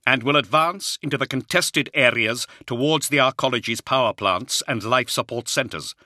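lightly voiced commander issuing orders; commander Luther. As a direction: press on firmly with the briefing.

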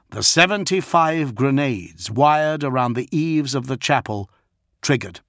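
ordinary delivery, nothing marked

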